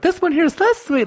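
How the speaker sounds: high-pitched imitation of a doting owner; put-on high cooing over a pet. Imagined doting pet owner's quote.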